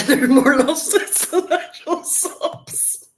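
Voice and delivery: high, slow words through real laughter; cracking up. Real, not acted. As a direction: laugh helplessly while getting the jibe out. Mocking rivals, cracks up.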